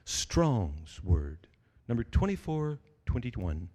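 low, deadpan reference-site narrator; computer speaker voice. Retold or imitated. Preacher imitating the Bible website's speaker reading the entry. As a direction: read it flat like a recorded voice. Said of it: "he says".

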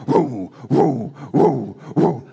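mock engine noise. Mimics neighbor's speeding lawnmower.

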